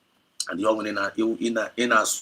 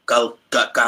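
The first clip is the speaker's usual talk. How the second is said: ordinary talk, nothing marked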